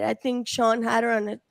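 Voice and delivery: ordinary talk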